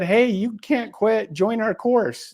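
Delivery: slow and high, talking her out of quitting; urging friend. Retold or imitated. Christina urging Jackie not to quit, high and slow.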